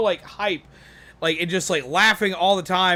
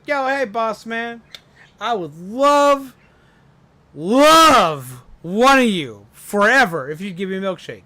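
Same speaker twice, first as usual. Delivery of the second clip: duck begging higher and drawn out; a pleading duck voice; 3 semitones higher, 6 dB louder than usual. Acted. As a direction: beg the boss man sweetly, stretching love.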